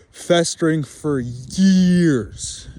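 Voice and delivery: slow, drawn-out brooding; dragged out. Real, not acted. Drunk man brooding over old grudges, slow and drawn out. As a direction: stretch every word out bitterly.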